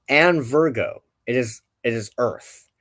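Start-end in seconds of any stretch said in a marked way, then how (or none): none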